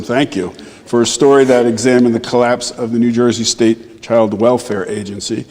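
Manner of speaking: ordinary talk, nothing marked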